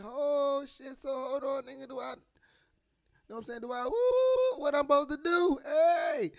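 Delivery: excited whoop. Retold or imitated. Man thrilled to find his roots, building to a shout. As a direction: work yourself up until you yell Hey.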